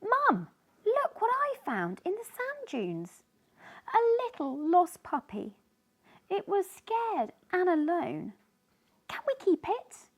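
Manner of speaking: put-on high, bright pleading child voice; a girl begging to keep a puppy; excited child. Grace begs her mum to keep the puppy, excited.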